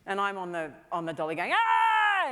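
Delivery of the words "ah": high, loud, drawn-out scream; full-lung scream. Retold or imitated. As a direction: re-enact screaming at the top of your lungs. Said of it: "screaming at the top of my lungs".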